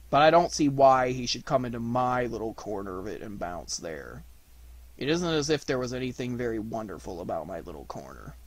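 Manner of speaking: low, glum, self-pitying donkey; downcast, weary complaint; gloomy Eeyore. Acted. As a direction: grumble along mournfully. Eeyore's long grumble.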